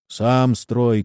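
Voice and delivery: usual speaking voice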